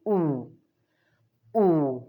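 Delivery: ऊ as a long "oo"; Spanish U vowel. Shown as a demonstration. Teacher models last vowel, long isolated ऊ. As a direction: hold ऊ alone, as in mood. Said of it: "कैसे pronounce करना है?".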